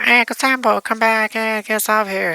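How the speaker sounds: high-pitched call to an uncle; imitating people calling for help. Everybody calls out to uncle.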